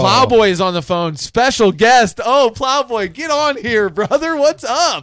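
high, loud radio-host hype; excited hype. Real, not acted. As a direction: welcome the caller like a star. Hyping up a special guest caller.

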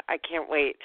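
normal talking voice

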